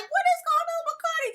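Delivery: high, fast, bewildered; shocked reaction. Retold or imitated. Vlogger retelling her shocked reaction, high and fast. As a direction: gasp it out in disbelief. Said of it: "I was like".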